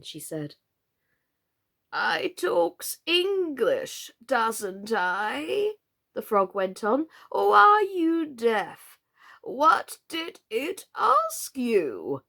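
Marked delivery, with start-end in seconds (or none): 1.9-5.8 very slow, higher croaky frog; slow frog drawl. A narrator's reading. Old frog answers Alice in a slow drawl. 7.3-12.2 very slow, higher croaky frog; slow frog drawl. A narrator's reading. Old frog answers Alice in a slow drawl.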